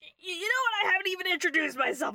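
high, loud, fast burst; perked-up drunk bard. Acted. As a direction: perk up suddenly and rush the words. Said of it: "perks up. He's like".

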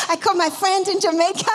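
retry her Jamaican lilt, a bit rushed and laughing; attempted Jamaican accent. Preacher retries Louisa's words, light Jamaican accent imitation. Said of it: "sorry, I can't do your accent".

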